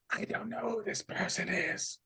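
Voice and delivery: a small, quiet disowning voice. Acted. Gnome woman disowning the visitor, high and soft. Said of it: "she looks at you and, and says".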